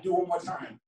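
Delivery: ordinary talk, nothing marked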